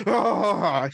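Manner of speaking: big yeti roar. Acted. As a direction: roar like an abominable snowman. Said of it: "his biggest Yeti voice".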